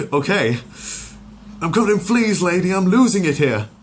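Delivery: high, loud, frantic rant; losing it, covered in fleas; frantic agitated dog. Acted. Brian snapping at Lois, high and frantic. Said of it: "as agitated as one can imagine".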